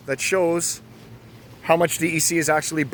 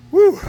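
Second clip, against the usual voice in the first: breathy woo of effort; exhausted woo; 9 dB louder than usual. Real, not acted. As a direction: blow out a tired woo. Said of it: "I have to climb all these stairs again".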